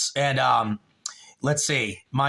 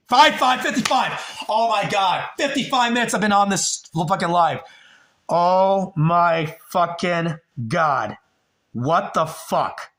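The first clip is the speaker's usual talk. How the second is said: higher-pitched burst, then drawn-out shock; stunned disbelief; 3 semitones higher than usual. Real, not acted. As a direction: exclaim in real astonishment, stretching the last words. Shocked at stream's running time.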